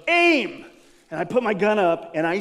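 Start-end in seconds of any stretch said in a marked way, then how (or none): 0.1-0.7 called command. Retold or imitated. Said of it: "And the guy goes".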